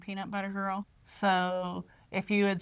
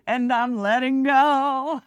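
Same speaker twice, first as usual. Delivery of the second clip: mock dramatic fall; 5 semitones higher, 9 dB louder, slower than usual. Acting Luke falling away.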